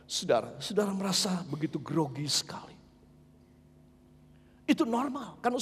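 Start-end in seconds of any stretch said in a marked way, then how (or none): 0.1-2.8 hushed whisper to the congregation; a whispered aside, barely voiced. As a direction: drop to a breathy hush as if confiding. Preacher drops to whisper.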